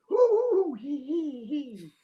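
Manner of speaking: high hoo hoo then falling, fading hee hee; strained panting hoots. Retold or imitated. Imitating strained bathroom noises.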